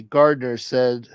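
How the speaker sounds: ordinary talk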